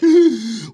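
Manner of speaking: sharp loud gasp of alarm; startled gasp. Retold or imitated. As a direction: gasp as if finding someone hurt. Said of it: "and I'm like".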